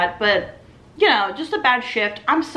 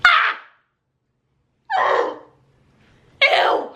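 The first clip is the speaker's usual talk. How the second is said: high disgusted shriek of surprise; shocked shriek. Retold or imitated. Waitress reacts to the creepy husband with a shocked shriek.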